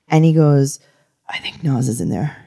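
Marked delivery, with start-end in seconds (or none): 1.3-2.4 hushed, breathy whisper, lower. Retold or imitated. Whispering to friends in the car about [name]. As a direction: whisper it so he won't overhear. Retelling spotting [name] outside pizzeria.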